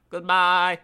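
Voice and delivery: loud, high goat-like farewell; bleated goodbye. A narrator's reading. Mother goat to her kids, loud bleat. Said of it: "the mother bleated goodbye".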